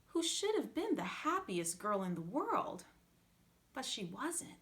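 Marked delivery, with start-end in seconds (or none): none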